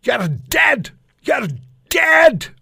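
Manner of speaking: high, loud, screaming military bark; bellowing sergeant yell. Retold or imitated. Petty officer screaming at a young sniper trainee, bellowing. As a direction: bellow it like a drill instructor at a recruit. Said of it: "bellowing voice screaming out".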